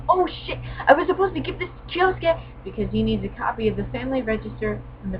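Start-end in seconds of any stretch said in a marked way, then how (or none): 0.1-2.4 high, sudden oh shit; high mock-panicked schoolboy voice; panicked realization. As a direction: realize you forgot something. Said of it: "and he goes".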